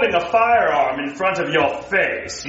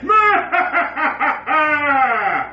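evil laugh; 4 semitones higher than usual. Acted. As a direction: cackle over the plan. Villain laughs.

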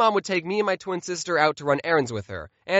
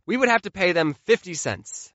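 usual speaking voice

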